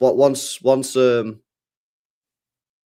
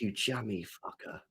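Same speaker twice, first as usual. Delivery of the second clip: much softer, under the breath; muttered envious aside; 4 semitones lower, 15 dB quieter than usual. Real, not acted. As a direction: grumble it jokingly. Jealous aside.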